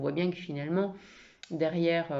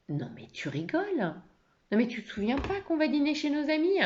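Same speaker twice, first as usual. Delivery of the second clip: indignant, pitch jumping high on the reproach; acted incredulous anger; angry; 9 semitones higher than usual. Wife reminding husband of dinner with friends, angry. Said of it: "qu'elle est en colère".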